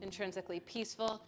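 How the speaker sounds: hushed, reverent prayer over music; gentle closing prayer to the class; soft devotional invocation. Acted. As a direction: offer the words like a quiet blessing. Devotional invocation and Sanskrit chant.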